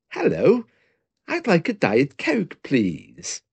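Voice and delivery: high, overly polite order; brave polite face. Retold or imitated. Desperate man orders a Coke from the barman, high and falsely calm. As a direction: pretend all is fine, just a bit too cheerfully. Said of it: "Put on a very brave face".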